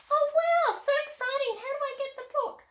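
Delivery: put-on high, loud little girl puppet squeal; Molly the puppet thrilled about the art pack, high and excited.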